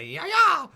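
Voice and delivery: loud, high "ya-ya" yelp; sudden high-pitched whooping cry; wild yell. Shown as a demonstration. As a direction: burst out in a frantic mock yell. Mimics drinking too fast, against regular speed.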